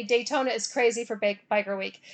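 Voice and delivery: ordinary talk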